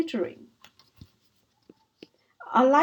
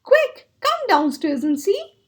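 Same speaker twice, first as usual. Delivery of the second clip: eager; 7 semitones higher, 4 dB louder than usual. Acted. Hare dancing, urging friends.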